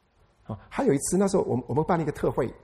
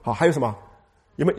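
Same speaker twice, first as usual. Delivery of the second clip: normal talking voice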